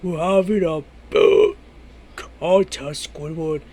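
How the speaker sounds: words belched out low; burping the words. Acted. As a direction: burp through the sentence. Burp contest, talking while burping.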